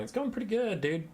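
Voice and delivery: high, bright fake cheer; upbeat fake cheer. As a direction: sell it like all is great. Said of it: "Wait, no, it's not".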